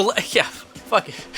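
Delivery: ordinary talk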